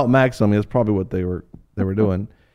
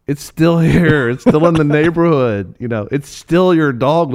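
insistent; 5 semitones higher, 5 dB louder than usual. Retold or imitated. Retold argument with the little girl.

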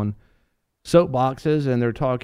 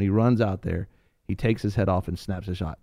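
ordinary delivery

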